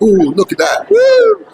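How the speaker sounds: showing off, ending in a very high woo; mock proud, squealing woo; proud devotee. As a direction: preen like a proud boy. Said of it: "devotee was very proud".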